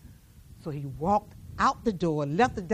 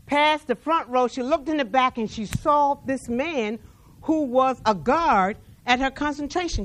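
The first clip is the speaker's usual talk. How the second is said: raised, louder preaching voice; 4 semitones higher, 5 dB louder than usual. Acted. Preacher telling the congregation the story, preaching hard. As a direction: lift the story to the back pews.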